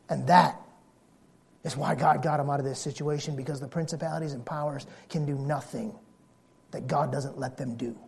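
low, very soft, grave emphasis; hushed preaching. Real, not acted. Preacher explains the powers' limits to congregation, hushed and low. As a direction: drop the voice right down for the weighty point.

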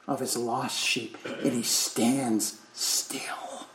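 very soft, slow, sinking to a whisper; hushed awe. Real, not acted. Preacher tells congregation God stops for sheep, hushed. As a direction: drop low and slow, let the words hang.

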